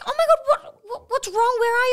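high, loud alarmed mother's voice; panicked mum. Retold or imitated. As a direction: shriek it like a frantic mum on the phone. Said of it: "She's like".

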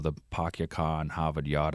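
half-hearted Boston accent, R's dropped; a shy, weak try at Boston R-dropping; mock Boston accent. Shown as a demonstration. Guest admits a bad Boston accent, softly attempted. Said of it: "I can't do it very well".

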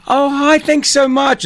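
high-pitched, gushing mock thanks; delighted customer. Customer thanking the man handing out free snacks, delighted. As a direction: gush it high, as a grateful customer would.

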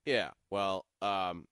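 low, slow reluctant coach; Bill. Retold or imitated. Coach answering the long-winded reporter, low and slow. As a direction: drag it out low and noncommittal. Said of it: "Bill's finally like".

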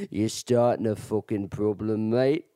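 low imitation of a menacing Irishman; Irish accent. Drunk Irishman threatening the giggling storyteller, Irish accent. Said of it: "And then he just goes".

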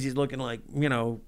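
ordinary delivery

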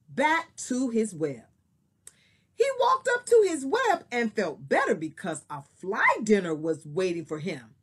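expressive storybook reading; story narration. Acted. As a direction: read it like a picture book. Teacher reads story aloud.

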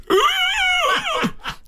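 very high, sustained mock howl; imitated bellowing. Storyteller mimicking a player hit in the scrum, high wailing bellow. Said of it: "this bellowing".

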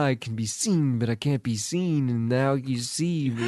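lightly voiced [name]; [name] impression. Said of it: "You sound like [name]".

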